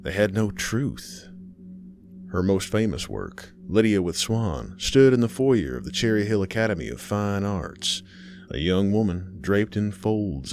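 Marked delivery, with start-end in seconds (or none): none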